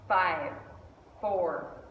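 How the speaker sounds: normal talking voice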